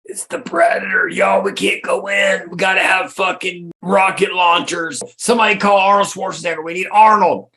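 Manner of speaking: high, frantic whisper-shout; squeaky panicked cop. Pretend. Mock panicked cops.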